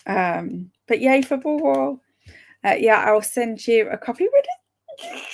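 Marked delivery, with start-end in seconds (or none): 4.3-5.2 squeaky, much higher really; can't believe the good news; overjoyed high squeal; thrilled disbelief. Real, not acted. Told she'll get a copy.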